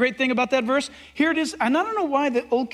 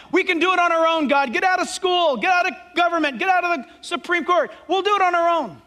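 raised, higher voice; defiant; 6 semitones higher, 4 dB louder than usual. Acted. World telling God to get out, loud and defiant. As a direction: push God away loudly.

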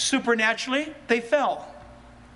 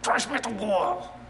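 softer, grumbling churchgoer put off by the truth; annoyed grumble; 5 dB quieter than usual. Acted. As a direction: mutter your irritation under your breath. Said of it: "you can say".

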